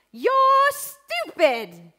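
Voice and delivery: shrill, very high, slow shriek of an English teacher; harsh teacher. Retold or imitated. Art teacher scolding student, shrieking high and loud. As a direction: screech it like the teacher who haunts you. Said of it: "In that voice that resonates in my head".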